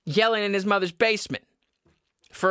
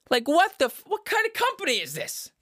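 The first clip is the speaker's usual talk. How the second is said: exasperated outrage; 12 semitones higher than usual. Real, not acted. Ranting at the sauna company, outraged high squeal. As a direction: pitch jumps way up in disbelief.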